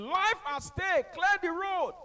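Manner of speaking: high, loud, slow megaphone call; ambulance shout. Retold or imitated. Ambulance driver clearing traffic, loud slow call. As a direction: announce the emergency over a loudspeaker. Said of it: "was shouting".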